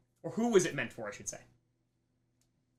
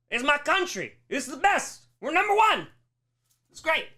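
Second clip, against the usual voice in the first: rising, higher boastful chant; mock nationalist pride; 7 semitones higher, 4 dB louder than usual. Teacher voicing a proud nationalist for students, boastful. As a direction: brag like a flag-waving patriot.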